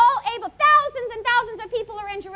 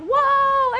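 crowd whoa; 4 semitones higher, 5 dB louder than usual. Acted. Excited crowd.

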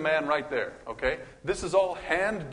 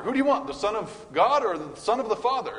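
crowd shouting; 7 semitones higher, 5 dB louder than usual. Retold or imitated. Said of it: "And they all said what?".

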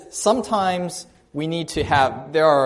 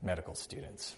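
muttered snicker; 10 semitones lower, 20 dB quieter than usual. Retold or imitated. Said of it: "snickering under his breath".

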